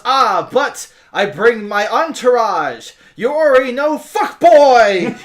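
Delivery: high, loud bragging, building to a shout; loud showy boast. Acted. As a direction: crow it at them, bigger as you go. Character brags about her entourage.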